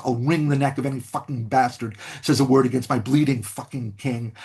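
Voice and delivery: usual speaking voice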